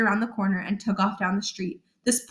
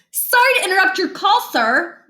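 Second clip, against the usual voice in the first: put-on overly polite boy; 10 semitones higher, 4 dB louder than usual. Boy sweet-talking a stranger.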